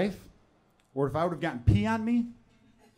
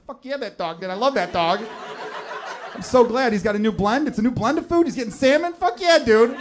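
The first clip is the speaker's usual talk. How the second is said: higher and louder, hyped fist-pump joy; pumped-up cheering; 6 semitones higher, 6 dB louder than usual. Retold or imitated. Man cheering a dog food commercial, loud hyped excitement. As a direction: cheer for the dog like it won the game. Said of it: "I'm like".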